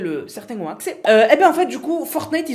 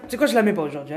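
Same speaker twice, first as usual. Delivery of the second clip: ordinary talk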